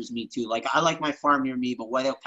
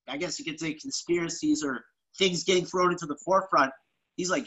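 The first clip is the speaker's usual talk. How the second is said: ordinary delivery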